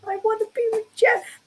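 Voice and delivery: high, fast pleading dog voice; the dog whining to get out to Jeff; voicing the dog. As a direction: beg to be let out like the dog. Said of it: "So he's like".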